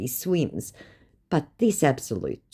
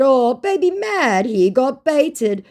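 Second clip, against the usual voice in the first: high, slow, louder teasing; mocking taunt; 12 semitones higher, 6 dB louder, slower than usual. Real, not acted. Gloating after the scammer swears, slow teasing singsong.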